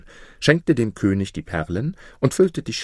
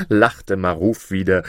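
usual speaking voice

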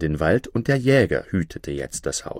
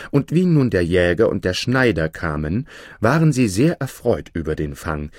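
ordinary delivery

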